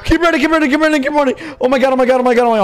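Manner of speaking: high, loud, frantic chanting; frantic high-pitched babble; panicked. Real, not acted. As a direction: urge it out while fleeing in panic. Fleeing in a game.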